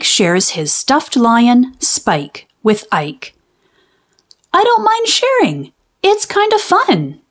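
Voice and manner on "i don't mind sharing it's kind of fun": put-on very high, quick little-boy voice; happy little boy. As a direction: say it like a kid proud to share. Mike happily shares his lion.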